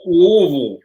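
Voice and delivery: Louisville slurred to LUH-vul; marbles in mouth. Shown as a demonstration. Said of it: "The tongue's gotta be in there".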